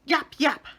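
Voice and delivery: quick, high, loud little yaps; sharp dog yaps. Acted. Andrew the dog barks sharply at Mary Poppins, high yaps. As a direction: bark it like a small dog. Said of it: "barked sharply".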